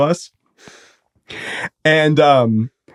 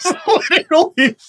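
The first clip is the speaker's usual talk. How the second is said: talking through laughter, higher; real incredulous laughing. Real, not acted. Storyteller tells friends how little was stolen, laughing in disbelief. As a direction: laugh helplessly while insisting. Laughing at absurdly small stakes.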